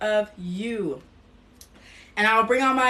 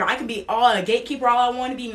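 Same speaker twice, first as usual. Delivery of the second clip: normal talking voice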